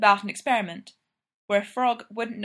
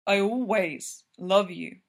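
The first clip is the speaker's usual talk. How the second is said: lightly voiced lover, gentle; slower than usual. A narrator's reading. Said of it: "Gino says gently".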